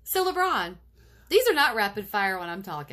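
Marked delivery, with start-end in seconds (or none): 0.0-0.7 high playful LeBron; mock-decisive. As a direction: declare it with a grin. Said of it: "mock-decisive restating".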